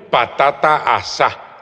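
slow "patata asá", stress on the final á; Papiamento pronunciation. Shown as a demonstration. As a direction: demonstrate "asá" just as it sounds, ending on the vowel. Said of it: "Como suena".

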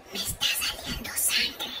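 slow, hushed whisper; confidential whisper. Acted. Sister confides in brother at school, whispered. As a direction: whisper it like a secret.

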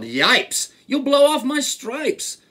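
high, loud yelp of alarm; zebra's yell. A narrator's reading. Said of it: "The zebra yelled".

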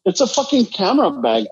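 ordinary talk, nothing marked

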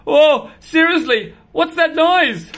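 loud, high, jumpy alarm; startled by every noise; alarmed cat. Acted.

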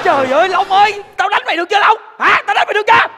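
angry shout. Acted. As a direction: bark the threat like a boss pushed too far. Boss loses patience, threatens friend.

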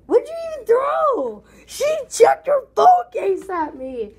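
high, loud disbelieving yell; outraged shout. Real, not acted. Kid yelling at sister who threw her phone case, loud outrage.

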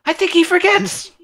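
excited exclaiming. Real, not acted. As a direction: exclaim it in disbelief. Player exclaims character forgets.

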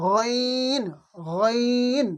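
গইন from the end of the throat, drawn out; throat-made গইন. Shown as a demonstration. Teacher modelling the Arabic letter, long throaty গইন. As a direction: make গইন in the throat and hold it. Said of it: "শেষ ভাগ থেকে উচ্চারণ হয়".

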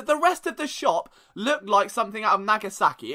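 ordinary talk

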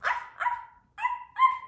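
very high squeaky arfs; tiny pup yaps. Pretend. Child playing the little pup with high yaps. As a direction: yip like a tiny puppy.

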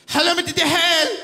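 exaggerated call-centre voice. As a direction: repeat the greeting brighter and bigger. Operator repeats greeting.

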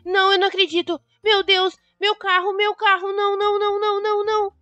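desperate cries of disbelief; panic. Acted. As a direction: freak out over the stolen car. Discovers her car stolen.

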